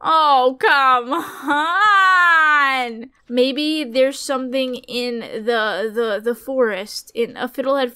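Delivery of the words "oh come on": frustrated plea. Real, not acted. Pleading for good item.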